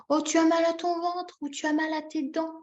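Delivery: high, held, cooing sympathy; parent-to-baby voice; concern for a baby. Shown as a demonstration. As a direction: fuss tenderly over a hurting little one. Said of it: "je fais par exemple".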